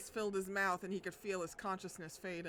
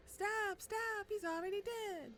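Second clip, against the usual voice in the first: much higher, pleading cry to stop it; 13 semitones higher, slower than usual. Acted. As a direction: beg them to quit, voice way up. Reader reacting to game beating.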